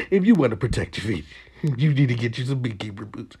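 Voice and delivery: lightly voiced infomercial close, fast; fast ad read. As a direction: rattle it off like a hurried ad tag. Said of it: "That's not a commercial".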